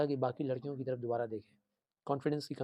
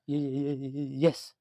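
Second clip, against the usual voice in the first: stammering; slower than usual. Acted. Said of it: "ऐसे बोलो, हकलाते हुए".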